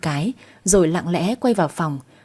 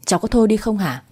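usual speaking voice

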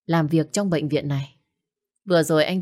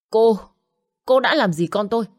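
loud, higher furious accusation; angry demand; 5 semitones higher, 4 dB louder than usual. Acted. To the woman he blames, a loud angry demand. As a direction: confront her over the lost child. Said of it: "Giọng nói giận dữ của Nhật Đông".

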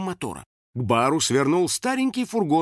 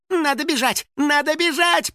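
very high, rising frantic cries; panicking; 4 dB louder than usual. A narrator's reading. Финик dashes about the kitchen, panicking. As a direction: squeal it in panic, louder the second time. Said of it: "паниковал Финик".

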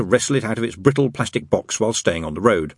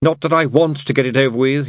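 hurried; 8 semitones higher, 4 dB louder than usual. A narrator's reading. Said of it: "he added hurriedly".

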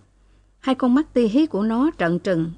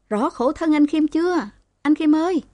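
high, tearful cry; near sobbing; 7 semitones higher than usual. A narrator's reading. Woman cries out in pity for Kim, high and tearful. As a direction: call out as if about to burst into tears. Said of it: "như bật khóc".

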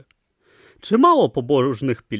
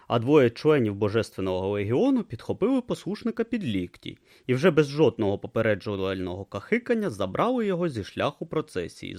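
ordinary talk, nothing marked

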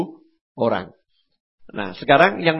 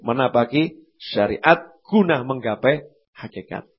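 usual speaking voice

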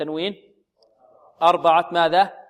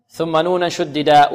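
usual speaking voice

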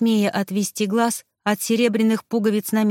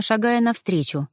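ordinary delivery

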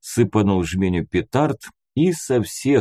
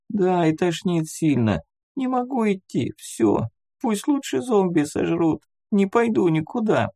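put-on high, plaintive child voice; worn-out little boy; 10 semitones higher than usual. A narrator's reading. Sick boy telling the man he gives up, high plaintive voice. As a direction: whine it high and weakly, refusing to go on.

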